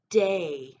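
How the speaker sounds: "day" said loud as one word; blended word. Shown as a demonstration. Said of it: "if you put those two sounds together".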